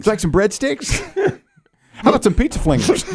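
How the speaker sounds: high, bright upselling cashier voice; chirpy pizza counter upsell; customer service voice. Retold or imitated. Mimicking pizza-shop upselling.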